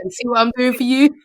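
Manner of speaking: loud, high mock plea to a daughter; retold showy dad. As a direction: play up the dad's big self-justifying question. Acting out dad looking at daughter.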